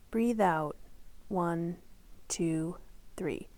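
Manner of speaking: fading exhale count; breathe out count. Acted. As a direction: let the count sink as the breath goes out. Leads a breathing exercise.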